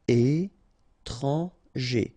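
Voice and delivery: very slow "étranger", é-tran-ger; syllable by syllable. Shown as a demonstration. French teacher modelling for learners, "étranger" slowly syllable by syllable. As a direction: pause between each syllable of "étranger".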